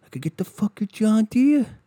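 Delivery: high brag on John Deere; dudebro brag. Retold or imitated. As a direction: show off the mower like a trophy. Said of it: "these fucking people are like".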